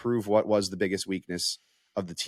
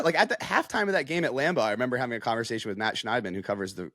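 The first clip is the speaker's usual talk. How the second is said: ordinary talk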